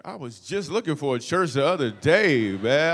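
loud, hammed-up enthusiasm; big, drawn-out, eager delivery; over-eager agreement. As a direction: agree loudly, far too eagerly. Eager yes to the beautiful woman.

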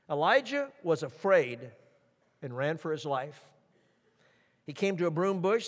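normal talking voice

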